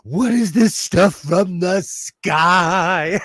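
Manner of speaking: slow, hammed-up puzzled question; mock-baffled Californian; mock bewilderment. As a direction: play dumb about the rain. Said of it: "and we're like".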